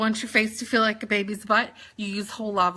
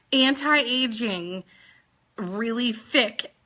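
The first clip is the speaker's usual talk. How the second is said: slow and deliberate; slower than usual. Shown as a demonstration. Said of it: "had to slow that down".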